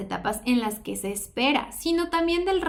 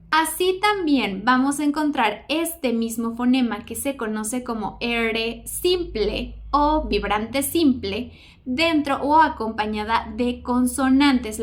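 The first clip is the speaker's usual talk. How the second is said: usual speaking voice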